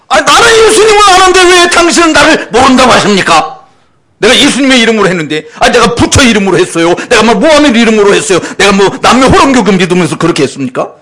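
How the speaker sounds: shocked, loud objection; indignant protest. Acted. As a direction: protest the injustice at full volume. Rejected people protesting to Jesus.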